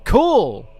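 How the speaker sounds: high, loud, forced cheer; badly faked excitement. Shown as a demonstration. Streamer attempting an excited cry, hammed-up and unconvincing. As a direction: try to sound excited and overdo it. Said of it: "How do you, like, sound, like, excited".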